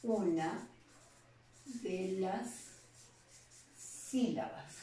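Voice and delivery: dictating slowly, word by word, with long pauses; slow dictation. Shown as a demonstration. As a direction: spell out the definition slowly so students can copy it. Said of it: "vamos a definir el acento como". (dictado lento, palabra por palabra)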